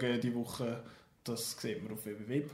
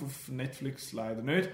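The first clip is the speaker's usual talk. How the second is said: usual speaking voice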